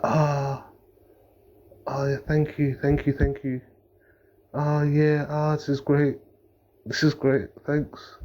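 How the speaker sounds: high, mock-grateful girlfriend; holding back laughter. Girlfriend trying not to laugh at his tearful proposal, mock gratitude. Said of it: "I think she wanted to laugh".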